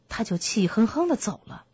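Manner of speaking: ordinary delivery, nothing marked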